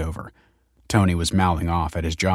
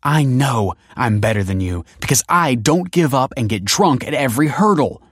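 loud, raised, cutting comeback; angry retort; 5 semitones higher, 5 dB louder than usual. Acted. Kelsey tells drunk Tony she is better, loud and angry. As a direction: hit back hard, pitch climbing.

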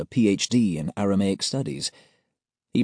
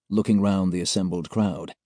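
ordinary delivery

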